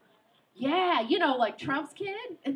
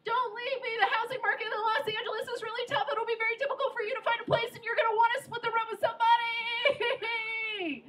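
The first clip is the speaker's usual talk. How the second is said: higher, fast, half-laughing plea; mock boyfriend plea; 8 semitones higher than usual. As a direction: rattle it off higher, laughing as you go. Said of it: "which was".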